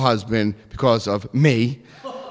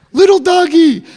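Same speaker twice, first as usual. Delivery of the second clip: put-on high, loud and childlike; childish high self-introduction; high little-boy voice; 12 dB louder than usual. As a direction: squeak your own nickname like a kid. Introducing himself at door, sheepish.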